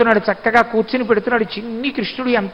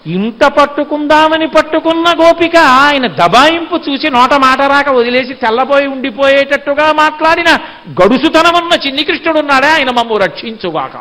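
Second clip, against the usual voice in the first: high, sustained preacher's intoning; devotional declamation; 4 semitones higher, 4 dB louder than usual. Acted. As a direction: carry the long sentence up on a raised tone. Declaims the meaning as invocation. (స్వరం పెంచి భక్తిగా ప్రవచిస్తూ)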